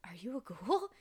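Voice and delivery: whispered, hesitant question; acted stunned whisper; hushed Kimi. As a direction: whisper it haltingly, as if just realizing. Kimi's shocked question, retold.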